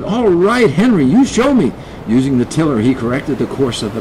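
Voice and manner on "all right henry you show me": high, urgent call; shouting kid. A narrator's reading. Kid steering, trusts the cat, shouting. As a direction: call out, ready to follow. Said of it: "the kid shouted".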